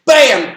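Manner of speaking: loud, high punchy bam; mock impact noise; sound effect bam. Shown as a demonstration. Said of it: "sound effect for a hit".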